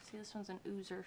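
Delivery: usual speaking voice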